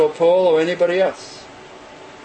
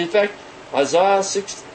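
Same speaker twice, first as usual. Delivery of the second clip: usual speaking voice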